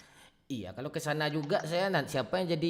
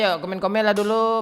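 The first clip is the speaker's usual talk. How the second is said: calling out; 9 semitones higher, 11 dB louder than usual. Real, not acted. Calling viewers to comment.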